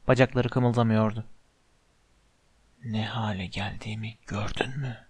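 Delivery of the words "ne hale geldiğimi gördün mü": low, hushed villain voice; sinister whispering face. A narrator's reading. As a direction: speak low and very softly, menacingly. (alçak, fısıltılı kötücül ses)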